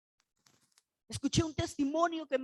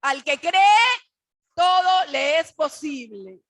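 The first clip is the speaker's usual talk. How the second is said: shouted at full force, slow on the second phrase; preaching shout; 12 dB louder, slower than usual. Real, not acted. Preacher to congregation, proclaiming faith in a loud, slow shout.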